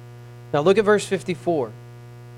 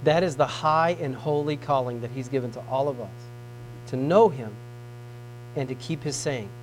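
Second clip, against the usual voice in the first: tender appeal; 6 semitones lower, 6 dB quieter than usual. Acted. Preacher closing tenderly to congregation, soft and low. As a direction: gently invite them in.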